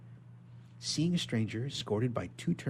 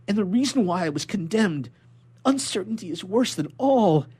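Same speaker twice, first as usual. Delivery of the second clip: higher, louder urgent entreaty; desperate begging; 7 semitones higher, 8 dB louder than usual. Acted. Prisoner begging the inspector, higher and louder. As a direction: plead as if it's the last chance.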